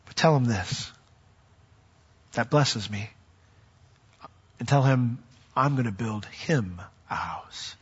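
dropping to a soft, breathy near-whisper for the secret promise; hushed promise. Acted. God confiding his promise to Nathan, hushed. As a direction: lean in and hush it like a secret.

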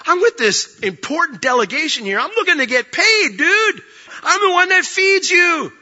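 high, indignant rant building in force; acted exasperated tirade; Balaam, mad. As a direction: build to a high-pitched, outraged protest. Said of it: "he is so mad".

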